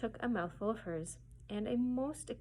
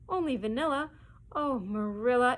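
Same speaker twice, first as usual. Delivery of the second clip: higher and louder, incredulous; shocked; 4 semitones higher, 6 dB louder than usual. Acted. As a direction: disbelief rising on the question. Marilla shocked at flavoring.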